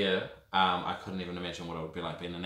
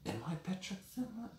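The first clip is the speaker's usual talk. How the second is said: inner doubt; 10 semitones higher, 13 dB quieter than usual. Retold or imitated. Thinking alone in cell.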